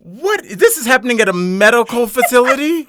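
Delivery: high-pitched scandalised disbelief; hammed-up shocked complaint; mock outrage. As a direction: act appalled, stretching the last words. Co-host plays shocked outrage.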